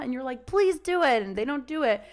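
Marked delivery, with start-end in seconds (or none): 0.4-1.2 higher, louder pleading; begging owner. Retold or imitated. Owner begging the dog to obey, higher and louder.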